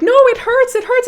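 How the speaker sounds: high, loud mock whine of a child; a hammed-up kid's wail; imitating a crying kid. Aunt mimicking her niece wailing at the tight belt. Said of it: "Kid is, like, crying".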